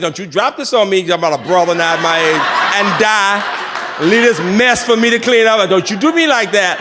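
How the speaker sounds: high, loud comic outrage; acted anger. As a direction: scold your mother in mock fury. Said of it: "I'm looking real angry".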